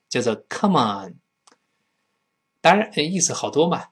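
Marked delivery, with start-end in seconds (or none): none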